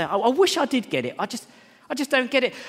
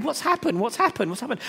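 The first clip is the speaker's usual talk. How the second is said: puzzled asking; 8 semitones higher than usual. Retold or imitated. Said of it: "And I said".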